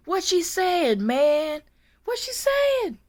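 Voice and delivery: high, loud retold demand; mock exasperated yell. Aide retells begging laughing co-workers to translate, mock yell. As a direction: call it out high and loud, imitating a desperate plea.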